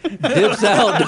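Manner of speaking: usual speaking voice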